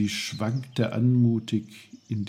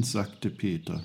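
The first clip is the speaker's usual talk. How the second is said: ordinary delivery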